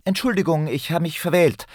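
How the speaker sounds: put-on high-pitched, flustered boy's apology; hasty apology. A narrator's reading. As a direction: blurt a hasty sorry in a high voice. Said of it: "sagte er hastig".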